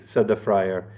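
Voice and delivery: plain reading voice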